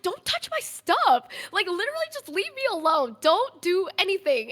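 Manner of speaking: high, strained don't-touch-me plea; upset refusal. Retold or imitated. Telling him to leave her alone.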